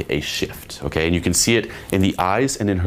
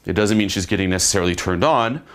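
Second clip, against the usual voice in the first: ordinary delivery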